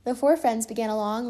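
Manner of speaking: ordinary delivery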